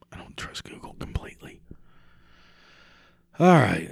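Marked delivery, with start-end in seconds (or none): 0.1-1.6 whispering. Real, not acted. Muttered aside.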